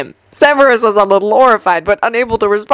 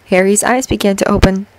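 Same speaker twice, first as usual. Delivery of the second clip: ordinary delivery, nothing marked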